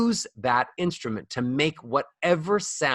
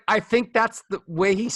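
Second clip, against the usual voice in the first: usual speaking voice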